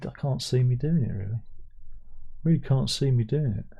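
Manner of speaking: ordinary talk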